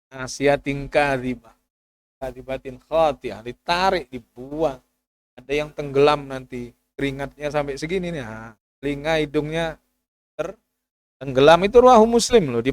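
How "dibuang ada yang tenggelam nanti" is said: usual speaking voice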